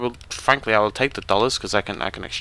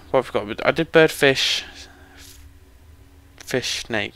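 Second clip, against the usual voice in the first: ordinary talk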